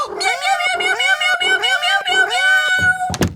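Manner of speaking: very high, loud mewing; repeated high loud meows; frantic cat. Acted. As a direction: cry like an upset kitten. Kitty cries after the snow.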